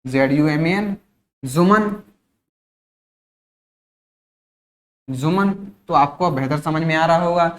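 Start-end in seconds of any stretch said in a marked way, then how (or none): 1.4-5.5 जुमन with a buzzing z at the start; जुमन, z-sound onset; wrong pronunciation. Shown as a demonstration. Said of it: "नाम जुमन हो जाना चाहिए".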